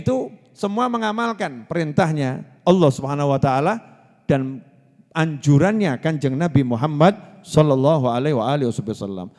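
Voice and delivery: normal talking voice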